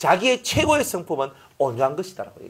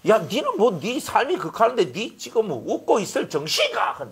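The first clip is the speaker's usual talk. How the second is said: higher-pitched, incredulous scolding; mock indignant challenge; 5 semitones higher than usual. Critic asking how you can smile in hardship, acted indignation. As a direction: needle them with exaggerated disbelief.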